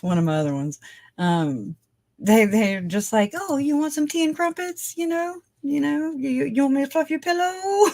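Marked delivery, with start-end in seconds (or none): 3.3-7.9 high, singsong sarcastic offer; mock fawning. Mocking cops' pampering of a suspect, high and fawning. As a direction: coo it high, laughing at the end.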